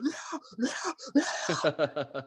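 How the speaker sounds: high, thin mock wail of a singer; mock band vocals. Frontman blasting into a brutal song, mock wailing.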